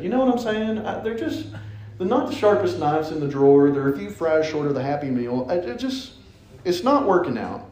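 normal talking voice

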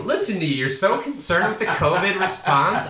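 high, loud playful taunt; mock teasing. Mock teasing, higher louder.

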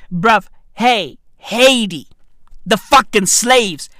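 ordinary talk

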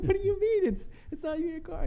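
very high, incredulous squeal; hammed-up baffled protest; mock disbelief. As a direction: act out utter confusion, voice shooting up. Retold disbelief at reply.

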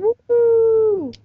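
high, loud drawn-out woo-hoo; excited whoop. Real, not acted. Host cheering that the episode comes before her birthday, loud whoop. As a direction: whoop like you've just won something. Celebrating release date mix-up.

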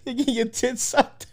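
slow, high laughing words. Real, not acted. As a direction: laugh it out slowly. Laughing repeat.

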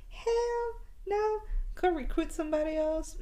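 high, emphatic refusal; defiant refusal. Pretend. Refusing the Other Mother loudly.

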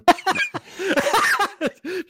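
high, bright burst of laughter; real laugh. Real, not acted. Co-host cracking up at the podcast banter, loud laugh. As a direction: laugh at your own joke.

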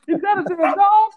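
high shocked laughing question; surprised laughing. Real, not acted. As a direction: burst out in shocked disbelief. Reacts to dog sound effect.

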